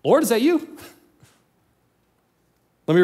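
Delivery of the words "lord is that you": high-pitched, tentative question; acted disciple's startled question; questioning Peter. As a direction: call out unsure, pitch climbing to the end. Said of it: "And Peter's like".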